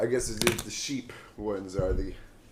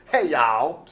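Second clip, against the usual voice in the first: mock Southern accent; 2 semitones higher, 8 dB louder than usual. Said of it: "she came up on a horse".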